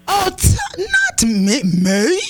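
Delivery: high, drawn-out "who, me?" protest; mock-offended disbelief. Real, not acted. Host answers co-host's accusation of snobbery, mock-offended. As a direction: protest innocence, high and slow. Accused of thinking herself better.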